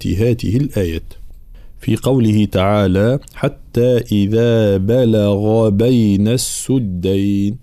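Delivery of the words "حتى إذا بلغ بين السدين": slow, measured tajweed recitation; Quran recitation. Shown as a demonstration. Teacher reciting the verse for correction, slow tajweed recitation. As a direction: recite slowly, drawing out each long vowel.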